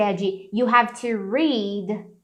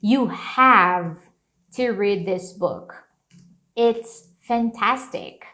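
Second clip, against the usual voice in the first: slow delivery, pausing after "have"; measured, spaced-out urging with weight on "have"; emphatic recommendation; slower than usual. Shown as a demonstration. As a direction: lean on "have", then stretch "fantastic" slowly. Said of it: "então ficaria assim, olha".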